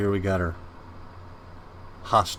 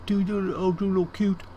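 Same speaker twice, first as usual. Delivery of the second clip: cooing over a pet; 7 semitones higher than usual. Real, not acted. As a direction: coo at a cute kitten, high. Cooing at a kitten.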